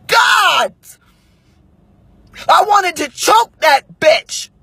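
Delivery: high, drawn-out furious exclamation; exasperated angry shout; real rage. Real, not acted. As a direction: let the fury burst out, stretching the words.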